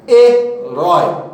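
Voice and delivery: herói drawn out syllable by syllable, stress on rói; slow syllabified word. Shown as a demonstration. Teacher to students showing the stressed syllable, slowly.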